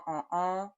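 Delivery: uh, nasal a with half the air through the nose; correct nasal vowel. Shown as a demonstration. Teacher demonstrates the correct nasal vowel. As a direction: hum the nasal vowel lightly. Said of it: "that's correct".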